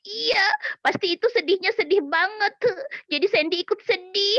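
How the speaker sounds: put-on a puppet child crying along. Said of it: "ya nangis lagi".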